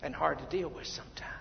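soft, fast whispered line; hushed aside. Acted. Preacher hushing the congregation with a soft whisper. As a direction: drop to a whisper.

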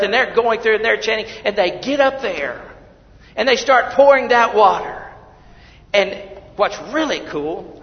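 loud, high sermon voice; fiery preaching. Acted. Preacher describing the water ceremony, fiery preaching. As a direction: drive the story home to the pews.

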